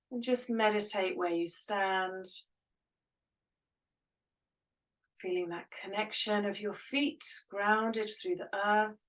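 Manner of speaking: calm meditation guide voice; guided meditation. Acted. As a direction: ease them into stillness.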